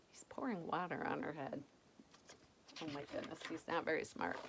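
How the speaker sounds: fast hushed whisper; whispered aside. Real, not acted. Reader whispering an aside to the child. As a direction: whisper it to the listener.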